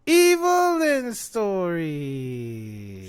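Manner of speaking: long, stretched wail sliding down; mocking drawn-out repeat. Real, not acted. Fan repeating it over friend's objection, drawn-out mockery. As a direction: drag out every syllable, letting it fall away.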